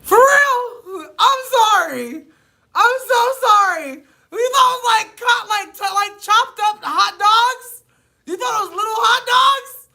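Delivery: helpless screaming laugh fit; real hysterical shrieks of laughter. Real, not acted. Shrieking laughter at hot dogs.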